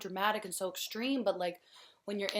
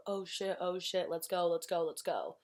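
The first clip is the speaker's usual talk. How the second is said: normal talking voice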